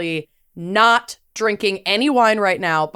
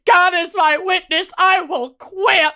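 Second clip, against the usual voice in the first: sweaty coworker voice; 8 semitones higher than usual. Retold or imitated. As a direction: wail it like a fed-up man. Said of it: "he's just going".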